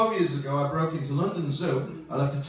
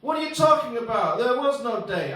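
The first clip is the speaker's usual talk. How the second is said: raised-pitch dismissal; mock indignant rebuff; 6 semitones higher, 3 dB louder than usual. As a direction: shut the date down, voice raised. Retold scornful rebuff by date.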